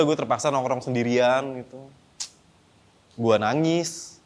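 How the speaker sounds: ordinary delivery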